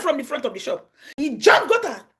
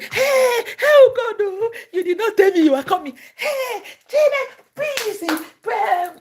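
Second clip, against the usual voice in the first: high, loud, laughing and crying fuss; mock overjoyed shriek; 13 semitones higher, 6 dB louder, slower than usual. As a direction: gush with tearful joy at a surprise guest. Mimicking an overjoyed believer.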